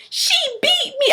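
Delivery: put-on high, small child voice; little daughter. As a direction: imitate a little girl confessing. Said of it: "She was like".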